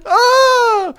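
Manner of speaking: loud high burst of laughter; shrieking laughter. Real, not acted. Host losing it after reading lyrics, shrieking laughter.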